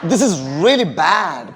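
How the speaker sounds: "really" swooping up and down, "bad" drawn out; expressive intonation. Shown as a demonstration. Teacher models intonation for the class, really bad exaggerated. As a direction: slowly swing through really and land on bad. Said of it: "ഇതാണ് ഇൻറ്റോണേഷൻ".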